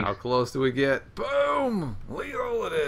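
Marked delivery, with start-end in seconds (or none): 1.1-2.9 high, drawn-out announcer whoop; triumphant call. Real, not acted. Commentator calling the lethal hit, triumphant. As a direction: stretch it out high, like calling a knockout.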